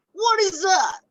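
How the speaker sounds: loud, high hammed-up grumpy old man; grumpy grandpa. Shown as a demonstration. Teacher voicing a grumpy grandpa for children. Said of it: "the grumpy grandpa".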